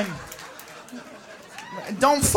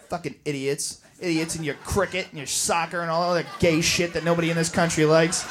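normal talking voice

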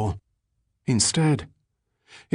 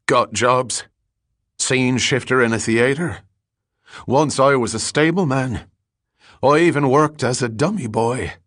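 lightly voiced derelict, raised pitch; Billy Collins; 4 semitones higher, 4 dB louder than usual. A narrator's reading. Ragged man telling a stranger his jobs, insistent and higher. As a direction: list old jobs with insistent pride.

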